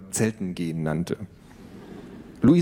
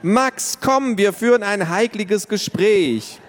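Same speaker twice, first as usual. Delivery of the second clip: put-on high, slow, loud child voice; little sister summoning her brother; excited little girl calling; 11 semitones higher, 4 dB louder, slower than usual. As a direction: call the brother in eagerly. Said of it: "rief Tiffany".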